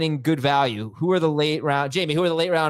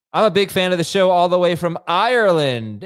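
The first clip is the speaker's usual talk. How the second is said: put-on failed Irish accent; 5 semitones higher, 5 dB louder than usual. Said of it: "Trying to do an Irish accent?".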